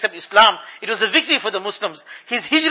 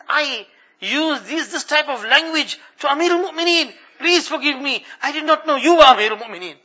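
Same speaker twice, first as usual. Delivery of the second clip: ashamed apology; 6 semitones higher than usual. Retold or imitated. Shamed woman apologising to the caliph, mildly pleading. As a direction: beg forgiveness with her head down, lightly voiced.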